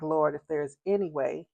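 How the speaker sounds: soft murmured prayer; quiet plea to God; silent prayer. Retold or imitated. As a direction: pray under your breath. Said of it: "I'm just in my mind".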